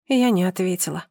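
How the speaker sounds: plain reading voice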